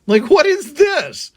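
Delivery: mock alarmed what-the-hell; shocked disbelief. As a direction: squeal it like you can't believe it. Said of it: "And I was just like".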